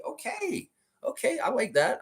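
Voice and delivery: high, squeaky, flattered "Okay."; a sudden high squeal of pleased surprise on "Okay."; delighted squeak. Real, not acted. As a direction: caught off guard by praise, squeaking "Okay.". Flattered by a fan's praise.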